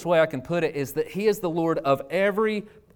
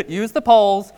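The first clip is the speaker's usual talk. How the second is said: laughing rule; 6 semitones higher, 8 dB louder than usual. Acted. Laughing while repeating rule.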